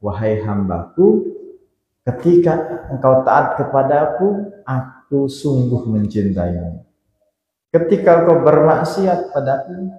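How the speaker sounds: slow, solemn, measured pronouncement; divine voice answering. Acted. God answers the servant at the mirror, slow and solemn. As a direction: let each phrase fall slowly and gravely. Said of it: "suaranya apa?".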